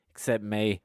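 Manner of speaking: normal talking voice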